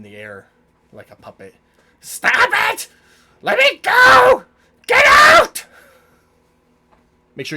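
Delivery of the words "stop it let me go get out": very high, loud outraged shrieks; panicked shouting. Acted. Gnome dangling like a puppet, shrieking in protest. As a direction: shriek as if helplessly hoisted in the air.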